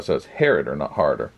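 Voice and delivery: Harroder said in full, set against Harder; Harroder vs Harder contrast. Shown as a demonstration. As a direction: stress the full Harroder, then the short Harder. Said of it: "I didn't pronounce it right".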